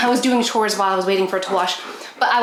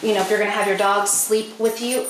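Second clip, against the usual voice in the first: ordinary talk, nothing marked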